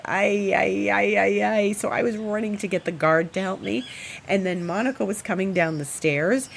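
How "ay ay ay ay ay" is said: landlady's ay ay. Retold or imitated. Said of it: "So she's said".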